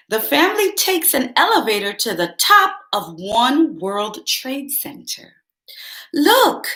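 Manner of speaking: lively picture-book read-aloud; bright storybook narration; reading to children. A narrator's reading. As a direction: read it like story time for kids.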